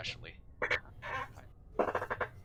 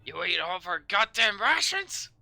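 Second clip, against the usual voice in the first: outraged sergeant; 4 semitones higher, 10 dB louder than usual. Acted. Sergeant reacting to the ration joke, exploding. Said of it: "He turns to you and says".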